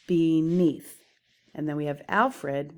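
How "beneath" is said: beneath with NEATH hit louder; stress on second syllable. Shown as a demonstration. Teacher showing the iamb in beneath, stress on NEATH. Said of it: "Beneath is whoosh".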